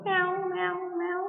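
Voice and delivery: high, drawn-out cat meows; imitated cat meows. Shown as a demonstration. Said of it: "Suara kucing". (tiruan suara kucing mengeong)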